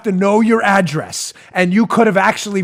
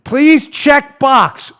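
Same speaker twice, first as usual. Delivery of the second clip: very slow, higher-pitched instruction; facetious reading; 4 semitones higher, 4 dB louder, much slower than usual. Acted. Host reading ballot directions to liberals, slow facetious readout. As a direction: read it out for a dimwit. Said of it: "I'm being facetious here and silly".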